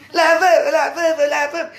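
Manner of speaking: mocking taunt. Mimics people taunting her.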